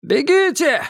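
shouting. Acted. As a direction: shout a frantic warning. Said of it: "Кто-то крикнул". (крик)

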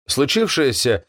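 usual speaking voice

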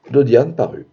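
usual speaking voice

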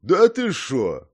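loud, high, drawn-out disbelief; astonished grunt. A narrator's reading. Old man hears monk was expelled, astonished. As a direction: stretch it out in amazement. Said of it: "от удивления крякнул старик".

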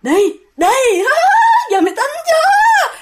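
very high laughing taunt; cackling ghost. Retold or imitated. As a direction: drag him off, cackling. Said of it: "vừa nói mà vừa kéo vừa giật".

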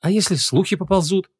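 hum, then voice the worry hesitantly; doubtful. A narrator's reading. Vanessa worries to the mage about rumours, doubtful. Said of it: "всё ещё сомневалась Ванесса".

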